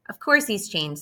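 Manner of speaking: usual speaking voice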